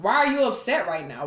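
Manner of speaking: ordinary talk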